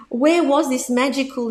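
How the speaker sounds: ordinary delivery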